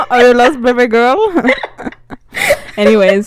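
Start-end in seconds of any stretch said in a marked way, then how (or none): 0.1-1.2 mock accent, a touch louder; sleazy pickup line. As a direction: imitate again.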